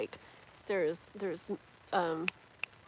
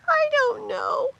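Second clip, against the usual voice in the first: very high, drawn-out mock-crying whine; playful whine; 8 dB louder, slower than usual. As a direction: wail it loudly in playful helplessness. Playful whining after apology.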